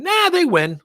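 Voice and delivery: high, loud mock brush-off; mocking dismissal. Speaker mocks rivals shrugging off the market, high mocking brush-off. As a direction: toss it off like a lazy rival.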